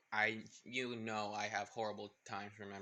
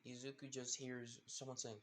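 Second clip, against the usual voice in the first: ordinary delivery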